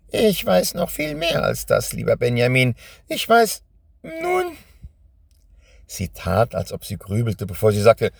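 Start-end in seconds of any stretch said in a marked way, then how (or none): 0.1-4.6 high, gloating witch voice. Acted. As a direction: play the witch, pitched way up. Witch speaks to Benjamin. (hohe, überhebliche Hexenstimme)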